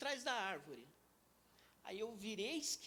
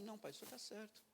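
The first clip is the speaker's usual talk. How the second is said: submissive reply; 9 dB quieter, faster than usual. Retold or imitated. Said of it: "simplesmente falava assim".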